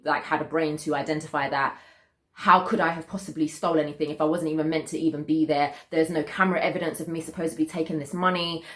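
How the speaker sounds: ordinary talk, nothing marked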